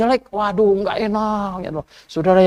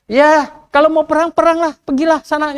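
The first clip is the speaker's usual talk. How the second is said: higher, mock-agreeing go-ahead; sarcastic prophet; 8 semitones higher than usual. Prophet Mika mocks Ahab, sarcastic go-ahead. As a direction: shrug them off sarcastically. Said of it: "dia bilang apa".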